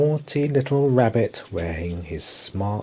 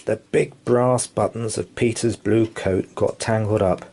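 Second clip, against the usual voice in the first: ordinary delivery, nothing marked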